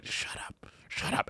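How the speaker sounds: hissed whisper; whispered hush. Retold or imitated. Viewer hushing a crying contestant, whispered. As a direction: whisper them to shut up. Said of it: "And you go".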